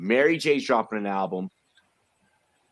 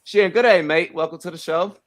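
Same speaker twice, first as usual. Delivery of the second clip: second try at a mock Australian accent, higher and louder; 6 semitones higher, 6 dB louder than usual. Host retrying his Australian accent for the guest. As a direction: give the Aussie greeting another go. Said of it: "That's like a four, man".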